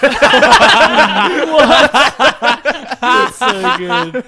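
loud, high incredulous laughter; laughing. Real, not acted. Friend hears licking story and bursts out laughing. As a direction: crack up in disbelief.

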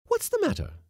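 very high woman's voice; inquiring lady. Acted. Lady cook to the irritated skipper, high inquiring voice. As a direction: pipe up with a question.

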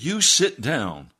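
reenacted commanding shout; stern command. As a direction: order him down, drawn out and forceful. Ordering the man down.

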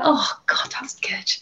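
breathy whisper after a long cry; spent whisper. Retold or imitated. To the host, recalling a cry, whispered. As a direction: whisper it like you've just finished sobbing.

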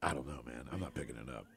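low hushed voice, frozen with fear; wife hushed and paranoid; paranoid whisper. Retold or imitated. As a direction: whisper as if the phone can hear.

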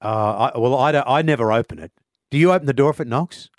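usual speaking voice

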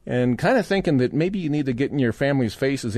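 normal talking voice

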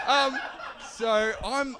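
high and laughing. Real, not acted. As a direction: break into laughter. Laughing mid-story.

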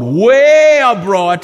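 slow and loud, way drawn long; stretched emphasis. Acted. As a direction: lean hard on way abroad. Preaching emphasis.

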